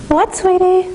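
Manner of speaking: high, sugary mom voice; sweet mom reply. Retold or imitated. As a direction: answer as the sweetly innocent mom.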